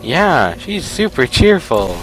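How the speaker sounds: loud, high, slow mock cheer; sarcastic. Real, not acted. Player commenting on the insulting game host, sarcastic. Ironic remark on the hostile game voice.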